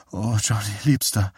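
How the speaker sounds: breath of passion, understated; aroused. A narrator's reading. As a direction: call the lover with mild arousal. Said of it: "Ihre Stimme wurde vor Erregung lauter".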